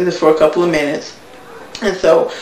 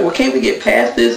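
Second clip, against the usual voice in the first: dismissive Stevie J; 6 semitones lower than usual. Retold or imitated. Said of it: "And he's like".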